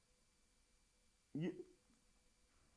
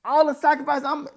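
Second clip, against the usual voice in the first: indignant outburst; 8 semitones higher, 9 dB louder than usual. Real, not acted.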